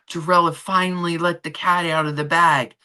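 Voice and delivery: ordinary talk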